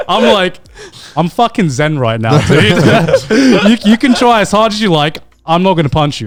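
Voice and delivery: laughing through a mock-zen reply. Telling the neighbor he won't punch him, laughing. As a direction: giggle while claiming to be calm.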